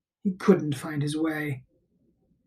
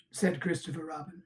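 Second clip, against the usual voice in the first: plain reading voice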